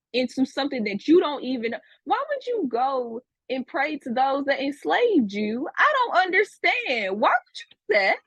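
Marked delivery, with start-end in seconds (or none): 5.8-8.1 high, disbelieving cry; can't believe they'd do it; incredulous. Real, not acted. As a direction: pitch shoots way up in disbelief. Incredulous outburst at students.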